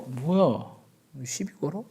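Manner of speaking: ordinary talk, nothing marked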